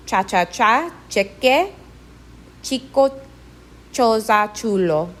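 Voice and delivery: cha-cha-cha, cheque, chico, choza, chulo with CH as in chip. Shown as a demonstration. Teacher modelling CH words, the sound in chip. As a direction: say cheque and chico with a crisp Spanish CH. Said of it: "just like the C H in chip".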